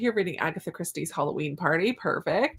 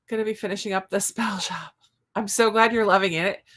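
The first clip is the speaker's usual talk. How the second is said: normal talking voice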